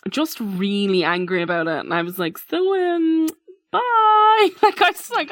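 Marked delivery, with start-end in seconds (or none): 2.4-4.5 high, loud fake crying goodbye; hammed-up tearful bye; mock wail. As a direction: whimper the goodbye. Leaving the angry father.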